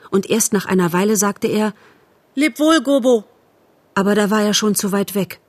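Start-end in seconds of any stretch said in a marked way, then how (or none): 2.3-3.2 high, raised-voice goodbye; called farewell. A narrator's reading. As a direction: call back to someone left behind. Farewell called after a friend left behind. (hoch nachgerufener Abschied)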